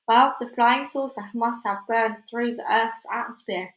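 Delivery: ordinary delivery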